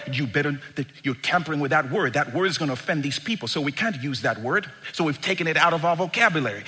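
fast, run-on fussing; quick mock caution; rapid word-policing. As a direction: rattle off the objections. Acted fussy word policing.

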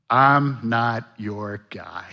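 very slow, dragged-out protest; slow excuse. Retold or imitated. Pastor voicing Moses refusing, slowly and reluctantly. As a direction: drag it out reluctantly. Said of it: "Fear response number five".

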